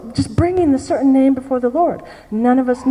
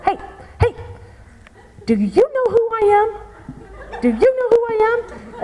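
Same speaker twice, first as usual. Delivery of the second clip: put-on funny old lady voice; 8 semitones higher than usual. Grandmother confronting the man who left her, high and funny. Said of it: "she talks in this very funny voice".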